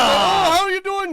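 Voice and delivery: mock Swedish accent. Co-host trying a Swedish greeting that comes out Canadian, high and loud. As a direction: ham up a Swedish local, sing-song. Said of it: "what do they say in Sweden".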